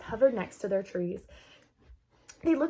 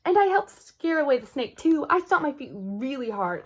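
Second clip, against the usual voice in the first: proud boast; 5 semitones higher, 6 dB louder than usual. Acted.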